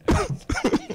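high-pitched giggle; squeaky giggle. Real, not acted. Stoned giggling on a call.

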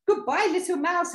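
high, loud goodbye; frightened owl farewell. Acted. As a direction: call out a flustered farewell.

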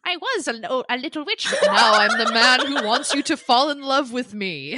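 mock Rochester voice. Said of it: "deepened his voice when he went back into Rochester".

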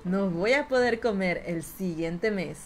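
low, measured seductive voice; sensual. Pretend. Streamer pleading with viewers for stars, sensual low voice. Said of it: "di una frase muy sensual con tu voz".

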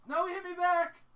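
very loud, high-pitched yell; alarmed scream. Real, not acted. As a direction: scream it as the monster strikes back. Monster hits the player back.

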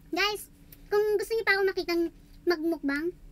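talking while chewing food; eating salad mid-sentence; mouth full, chewing. Real, not acted. Seen 0: Bite at -4, closed-mouth chewing to 12.3, later clearer speech.

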